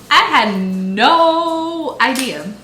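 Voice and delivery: "no" stretched long, high and loud; emphatic NO. Real, not acted. Mother admitting she did no research, emphatic drawn-out "no". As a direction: draw out "no" with big emphasis. Stressing how unprepared she was.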